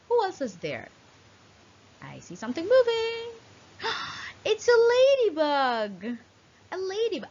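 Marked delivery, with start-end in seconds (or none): 2.0-7.3 high, fast, eager then a gasp; excited discovery. Acted. Teacher to children, an excited high discovery of the ladybug. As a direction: sound amazed at the ladybug.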